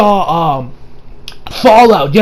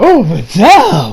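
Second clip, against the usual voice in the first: dreamy sigh; much slower than usual. Retold or imitated. Reviewer sighing over Videl, slow dreamy fall.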